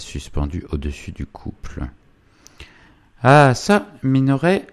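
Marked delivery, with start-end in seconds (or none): 3.2-4.7 high, louder demanding wife; Zélie. Acted. Wife rounds on her husband, sharply demanding. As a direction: call the husband to account, pitched up.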